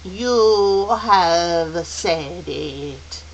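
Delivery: very slow, loud, drawn-out words; solemn, weighty elder voice; ringing pronouncement. Acted. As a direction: ring out, stretching each word. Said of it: "Missus Witch's voice rang out".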